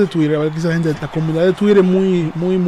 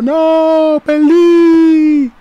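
much higher, mock wailing loser; mock crying wrestler; 10 semitones higher, 4 dB louder, much slower than usual. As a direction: whine it like a sore loser, hammed up. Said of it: "estaba llorando". (llanto burlón muy agudo imitando al luchador)

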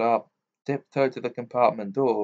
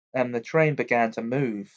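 usual speaking voice

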